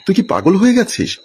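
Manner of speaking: high, shocked outburst of disbelief. A narrator's reading. Sister reacts to her brother's love confession, shocked and high. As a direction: recoil in disbelief, voice jumping up.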